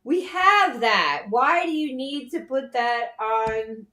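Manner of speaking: loud, slow, exasperated disbelief; emphatic "we have that". Real, not acted. Exasperated at partner.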